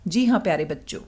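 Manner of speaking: normal talking voice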